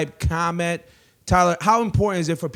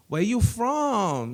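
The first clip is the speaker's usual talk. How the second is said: Mauricia; 7 semitones higher, much slower than usual. Retold or imitated.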